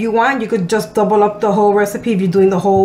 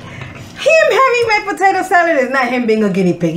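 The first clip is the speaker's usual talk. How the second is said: indignant shout; 7 semitones higher than usual. Real, not acted. Protesting the guinea pig claim.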